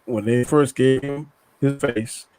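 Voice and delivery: ordinary delivery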